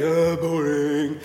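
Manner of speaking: very slow, drawn-out "Oh, boring."; sulky teenager. Retold or imitated. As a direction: groan it out like a bored teen. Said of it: "He says".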